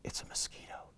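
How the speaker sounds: barely whispered; hushed reveal. A narrator's reading. Tiny animal revealed to listeners, hushed whisper.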